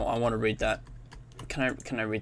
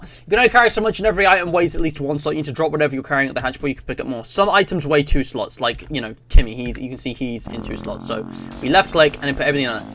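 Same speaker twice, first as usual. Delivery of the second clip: usual speaking voice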